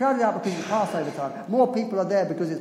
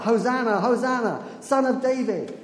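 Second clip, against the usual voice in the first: raised, higher cries of praise; crowd crying out; 5 semitones higher, 4 dB louder than usual. Retold or imitated. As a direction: call it out like a festive crowd. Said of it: "they're beginning to cry out".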